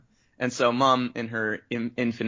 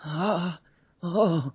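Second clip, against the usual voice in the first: high, open-mouthed anime gasp; blushing reaction gasps; 6 semitones higher than usual. Shown as a demonstration. Anime brother ogling the girls, flustered gasps. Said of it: "open mouth blushing reacts".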